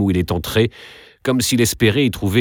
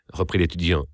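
ordinary delivery

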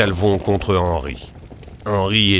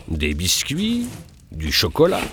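ordinary delivery, nothing marked

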